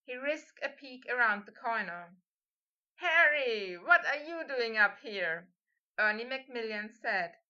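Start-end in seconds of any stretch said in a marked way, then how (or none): 2.9-5.5 loud, pitched-up call; bright-eyed surprise, voice raised; surprised greeting. A narrator's reading. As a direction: call out to a friend you didn't expect. Classmate calls out to Harry in surprise.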